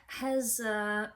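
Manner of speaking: ordinary talk, nothing marked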